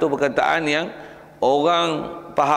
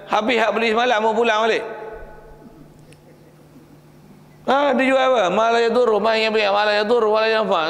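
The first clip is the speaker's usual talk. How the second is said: indignant buyer; 6 semitones higher than usual. Acted. Buyers returning idols, acted.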